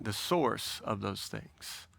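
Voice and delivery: normal talking voice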